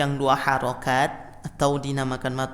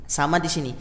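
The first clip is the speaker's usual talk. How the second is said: usual speaking voice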